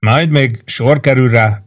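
ordinary delivery

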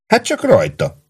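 light, high-pitched go-ahead; cheerful. A narrator's reading. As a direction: give breezy permission. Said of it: "mondta vidáman Prue".

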